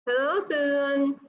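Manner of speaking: speaking while holding the tongue; holding tongue with fingers. Shown as a demonstration. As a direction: talk with the tongue pinched. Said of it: "See, I'm not able to do it".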